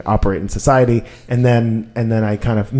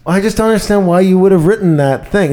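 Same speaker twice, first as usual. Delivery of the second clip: higher, louder mock mom voice, baffled; upset mom; 8 semitones higher, 5 dB louder than usual. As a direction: play the hurt, baffled mother. Mom upset about the article.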